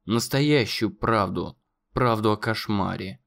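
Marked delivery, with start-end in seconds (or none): none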